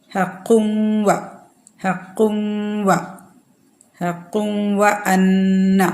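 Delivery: ഹഖും വ with the nūn merged into വ and nasalized, slowly; merged nasal. Shown as a demonstration. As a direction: hold the nasal hum into വ അന്ന. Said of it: "വാവിൽ വെച്ച് മണിക്കുക". (ഹഖും വ എന്നതിൽ നൂൻ വാവിൽ ലയിപ്പിച്ച് മൂക്കിലൂടെ മണിക്കുക)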